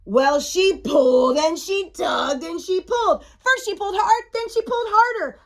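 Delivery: animated storybook build-up; building excitement. A narrator's reading. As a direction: narrate a struggle with mounting effort. Narrating a mounting tug of war.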